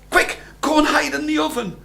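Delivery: put-on high, quick hurry-up to the boy; urgent warning. A narrator's reading. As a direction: rush him into hiding. Wife urges Jack to hide.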